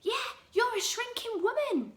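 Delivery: high mocking old man voice; taunting Mister Twit. Husband taunting his wife, mock high voice. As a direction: tease her in a put-on voice.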